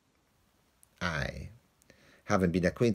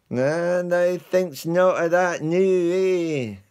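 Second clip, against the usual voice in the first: much higher, louder, slow; aggrieved complaint; 11 semitones higher, 8 dB louder, slower than usual. Acted. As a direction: drag it out, wounded. Lance complaining of ingratitude.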